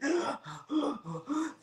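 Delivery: high breathy gasp of alarm; sharp panicked gasp. Retold or imitated. Reliving panic in the minivan, gasps. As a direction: catch your breath in fear. Desperation inside the car.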